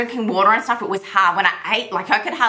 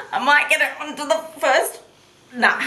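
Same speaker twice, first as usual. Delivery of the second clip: laughing; 6 semitones higher than usual. Real, not acted. Laughing while failing to fit.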